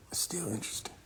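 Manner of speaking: tossed-off whisper; hushed aside. Real, not acted.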